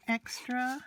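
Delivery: usual speaking voice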